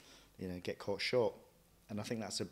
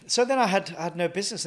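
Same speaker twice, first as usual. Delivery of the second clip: excited; 9 semitones higher, 10 dB louder, faster than usual. Real, not acted. Sudden lift, excited.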